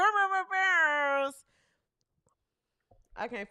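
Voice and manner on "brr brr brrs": drawn-out mouth-made horn noise; air horn imitation. Shown as a demonstration. Host giving a guest some horns, imitated air horn. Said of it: "give Ben Daniels some horns".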